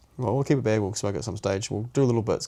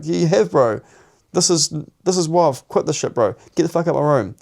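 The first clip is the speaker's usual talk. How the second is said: loud, high ordering him out; angry; 7 semitones higher, 5 dB louder than usual. Retold or imitated. Man ordering his mate out of his room, angry and loud.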